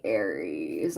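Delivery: very deep, quiet re-enactment of a slip; imitated weird delivery. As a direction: mimic the odd low, soft drawl. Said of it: "in a weird way too".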